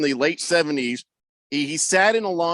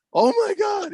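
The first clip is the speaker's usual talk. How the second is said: mock shock. Pupil just knocked over by his coach, high mock shock. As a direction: gasp it out in exaggerated amazement.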